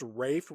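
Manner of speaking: Rafe, rhyming with safe; pronounced Rafe. Shown as a demonstration. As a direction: say Rafe with a long A and no L. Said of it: "why is his first name pronounced".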